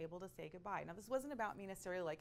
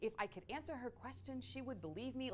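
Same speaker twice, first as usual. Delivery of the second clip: lightly voiced puzzled child, higher pitched; a hint of a kid's anxious wondering; bewildered nephew; 6 semitones higher than usual. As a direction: plead inwardly like a baffled little boy. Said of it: "He was looking at me like".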